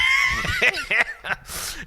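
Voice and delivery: high, loud burst of laughter; shrieking laugh. Real, not acted. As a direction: howl with laughter. Laughing at the joke.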